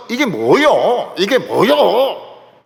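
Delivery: loud, drawn-out Jeolla dialect protest; imitated Jeolla complaint, stretched and loud; Jeolla-accent quarrel. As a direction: mimic a Jeolla squabble, slow swooping tune. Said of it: "전라도 사람들 싸우는".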